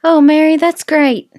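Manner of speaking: high, loud customer flattery; hammed-up gushing small talk; mock chirpy friendliness. Mocks repeating a person's name.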